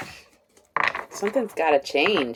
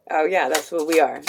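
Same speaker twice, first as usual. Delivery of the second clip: ordinary talk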